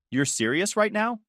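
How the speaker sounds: high-pitched, rising disbelief; incredulous question. Acted. Acted reply to mom's plan.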